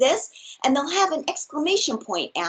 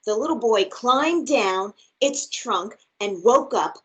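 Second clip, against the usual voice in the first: lively, expressive storybook read; reading to children; slower than usual. Acted. Teacher reads a picture book aloud to her class, bright and expressive. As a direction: read it big and playful for little listeners.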